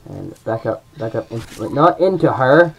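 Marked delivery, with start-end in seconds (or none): 1.7-2.7 panicked shout. Real, not acted. Panicked steering away from enemy.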